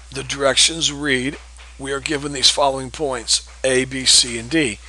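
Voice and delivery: ordinary talk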